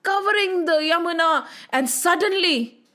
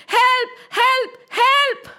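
high-pitched acted cries for help; panicked plea; 10 semitones higher, much slower than usual. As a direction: call out as the overpowered serpent. Serpent begs for help.

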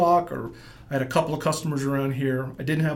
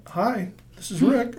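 chirpy phone greeting; 8 semitones higher, 4 dB louder, slower than usual. Retold or imitated. Calling back the editor.

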